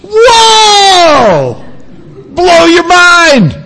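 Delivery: high, loud cry of wonder; preacher acting out sheer amazement; amazed whoa. Shown as a demonstration. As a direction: let it blow your mind. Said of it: "this is like".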